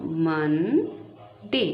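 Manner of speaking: Monday stretched out very slowly; slow model word for a child. Shown as a demonstration. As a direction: draw Monday out, syllable by syllable. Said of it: "इसको टू parts में".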